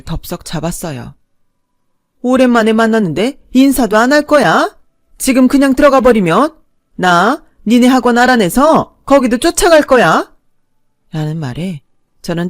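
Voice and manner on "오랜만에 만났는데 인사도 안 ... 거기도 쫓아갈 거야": 높고 큰 소리로 몰아붙이며 협박; 팔을 붙잡고 놓아주지 않을 듯 윽박지르기; 협박하는 시어머니. A narrator's reading. 시어머니가 팔을 잡고 협박. (며느리를 붙잡고 협박하는 시어머니, 큰 소리로 윽박지르며)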